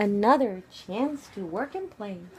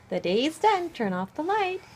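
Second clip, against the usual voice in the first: plain reading voice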